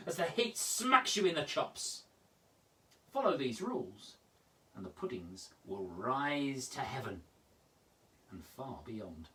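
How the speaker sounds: measured, dramatic poem reading; expressive poetry reading with big swells and hushed drops; poem recital. Acted. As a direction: savour each rule like a solemn ritual. Reads Ian's recipe poem.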